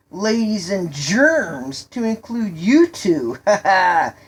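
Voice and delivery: mock your friend and crack yourself up; teasing jeer. Boy jokingly mocks his friend.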